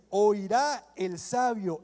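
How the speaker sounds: "Oirá el sabio" stretched slow with weight on "Oirá"; preacher contrasting the true verse with a false reading; slow emphatic quote. Acted. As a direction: lean on "Oirá" slowly and firmly. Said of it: "No, dice".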